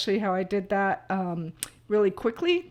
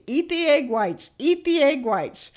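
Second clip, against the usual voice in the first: lightly voiced diet preacher, a little higher and louder; mock preachy advice; 5 semitones higher, 4 dB louder than usual. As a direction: parrot the fad advice with mild mockery. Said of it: "A lot of people out there I know are teaching".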